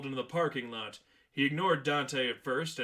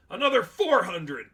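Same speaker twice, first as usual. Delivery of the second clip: higher, loud shouted figure; angry yell; 6 semitones higher, 9 dB louder than usual. Acted. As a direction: hurl the number at him. Manager yells extra cost.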